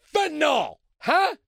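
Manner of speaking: needling smart Democrats; sarcastic jab. Real, not acted. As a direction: toss it at them with a sneer.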